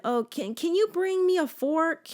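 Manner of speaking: mom asking a favor. Retold or imitated. Mom asking for a fork or drink, lightly imitated. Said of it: "my mom will be like".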